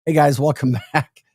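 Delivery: ordinary delivery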